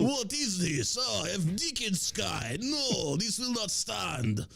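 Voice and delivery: a wounded Russian general in broken English; mock Russian accent. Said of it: "I don't see how Russians would be like".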